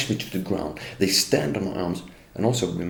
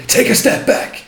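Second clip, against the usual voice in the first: high, very loud police command; barked order; 14 dB louder, slower than usual. Retold or imitated. Officer ordering the landlord back, very loud high bark. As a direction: shout him backward.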